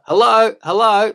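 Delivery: high, loud dopey hello; dopey country caller. Retold or imitated. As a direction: call out like a dopey yokel on radio. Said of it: "dopey people get on the radio".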